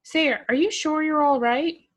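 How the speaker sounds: suddenly worried, voice shooting up; alarmed. Acted. Nephew alarmed by strange request.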